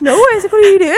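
high, loud startled question; re-enacted disbelief, pitched right up; startled protest. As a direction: retell it as a high, alarmed protest. Retells her startled protest at the proposal.

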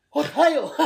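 high, laughing disbelief; stunned what the hell. Retold or imitated. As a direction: blurt it out mid-laugh like your brain locked up. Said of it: "It's like".

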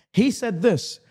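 ordinary talk

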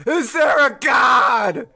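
slow, loud call to the heavens; loud and drawn out; shouted question. Acted. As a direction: shout it up to the sky. Calling out to the sky.

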